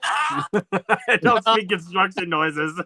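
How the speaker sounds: slow words squeezed through laughter; giggling repeat of a funny line; laughing. Real, not acted. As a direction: barely get it out while cracking up. Laughing while repeating clip line.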